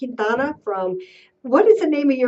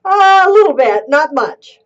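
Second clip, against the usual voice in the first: loud, high and pushed; shouting; 6 semitones higher, 9 dB louder than usual. Real, not acted. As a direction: raise your voice over a bad line. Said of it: "am I shouting?".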